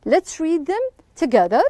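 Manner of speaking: usual speaking voice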